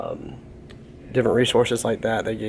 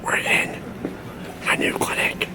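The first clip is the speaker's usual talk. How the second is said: hushed whisper; 4 dB quieter than usual. Real, not acted. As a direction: whisper so nobody hears. Entering clinic, quiet voice.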